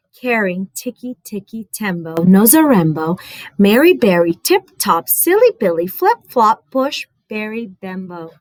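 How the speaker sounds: ordinary delivery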